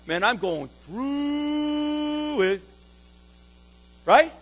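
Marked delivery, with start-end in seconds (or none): 0.1-2.6 slow, high, stretched reply; weary stretched-out sigh of a line; drawn-out groan. Shown as a demonstration. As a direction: drag it out weary and long. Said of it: "We should start a saying here".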